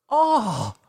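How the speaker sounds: high-pitched put-on "oh"; fake delight. Opening a gift good or bad, fake delight. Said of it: "no matter if it's good or bad".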